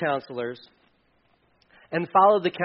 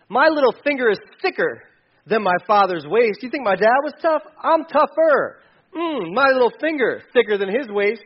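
higher, louder swaggering boast; tough king boast; 6 semitones higher, 6 dB louder than usual. Acted. Young advisors coaching the king to brag, swaggering. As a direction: puff up like a bully king.

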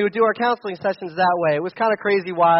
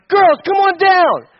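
high, loud, slow call; calling the girls; 11 semitones higher, 8 dB louder, slower than usual. Retold or imitated. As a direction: call it up the stairs. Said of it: "if we're like".

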